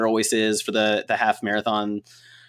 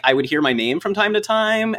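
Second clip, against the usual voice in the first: ordinary talk, nothing marked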